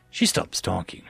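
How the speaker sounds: plain reading voice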